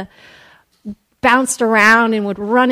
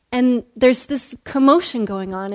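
ordinary delivery

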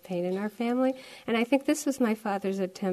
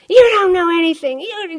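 imitating a raging dad, much higher and louder; furious father; 11 semitones higher, 14 dB louder than usual. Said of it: "my father just goes bonkers".

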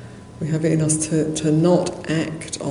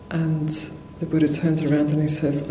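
normal talking voice

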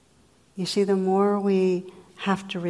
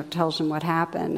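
ordinary talk

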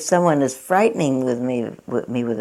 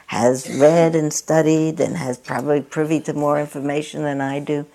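ordinary delivery

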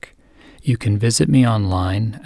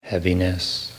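guided meditation; 2 semitones lower than usual. Real, not acted. Teacher easing a listener into the morning, calm guided meditation. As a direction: guide gently, unhurried, phrase by phrase.

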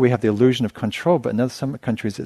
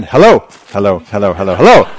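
loudspeaker announcement; 12 dB louder than usual. Retold or imitated. Teacher mimicking the bus ticket loudspeaker, loud blaring call. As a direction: boom it out like a cheap roadside loudspeaker. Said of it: "then it would start again".

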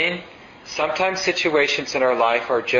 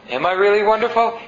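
higher, louder coy question fishing for flattery; needy for praise; 8 semitones higher, 4 dB louder than usual. Retold or imitated. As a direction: lightly play a teacher begging to be told he's wonderful.

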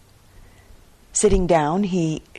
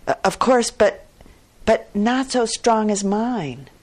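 louder, higher, tripping over the words; flustered stammer; 5 semitones higher, 4 dB louder than usual. Acted. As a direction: farmer flustered, defending his ox to merchant, stammering. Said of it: "stammered the farmer".

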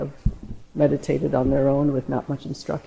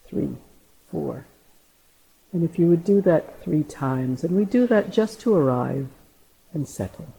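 guided meditation=32. Acted. Leads breathing count.

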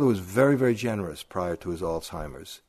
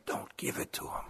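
hushed breathy whisper, no voice at all; whispered warning; 10 dB quieter than usual. Retold or imitated. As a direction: whisper it so the patient can't hear. Nurse warning family at bedside.

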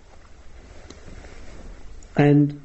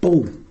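"boom" louder and higher as one hard beat; emphatic boom; 4 semitones higher, 4 dB louder than usual. Shown as a demonstration. Teacher answers a student's question, punchy "boom". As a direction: land "boom" like a single drum hit. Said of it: "more emphatic with this one".

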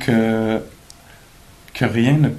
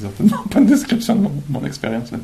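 laughing; 10 semitones higher, 3 dB louder, faster than usual. Real, not acted. Jokes about own phenomenal memory.

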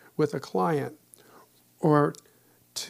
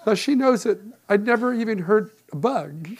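laughing; 5 semitones higher, 6 dB louder than usual. Real, not acted. Laughing at own story.

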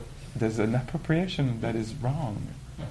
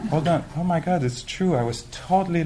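dawning realization; 6 semitones higher than usual. Retold or imitated. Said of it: "say like".

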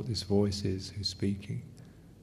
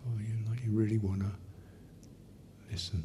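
much softer, gentle and respectful; respectful softness; 8 dB quieter, faster than usual. Shown as a demonstration. Teacher showing respectful tone to inner voice, quiet.

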